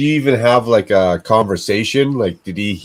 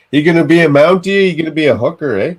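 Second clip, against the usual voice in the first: mock Canadian; 8 semitones higher, 4 dB louder than usual.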